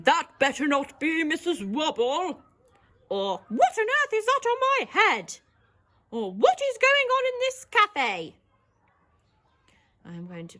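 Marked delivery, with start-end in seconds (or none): 0.1-2.4 higher, louder grumpy voice; grumpy manager. Shown as a demonstration. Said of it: "He might be saying". 3.5-5.4 high, loud indignant cry; outraged manager. Shown as a demonstration. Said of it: "He might be saying". 6.3-8.4 high, loud indignant cry; outraged manager. Shown as a demonstration. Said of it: "He might be saying".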